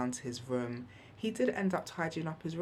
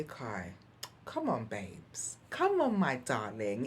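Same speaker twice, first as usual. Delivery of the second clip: softer and slower, tender coaxing to her son; gentle, soft voice; 4 semitones lower, slower than usual. Shown as a demonstration. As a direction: coax your son gently, like a loving mum. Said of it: "I take a breath and I say".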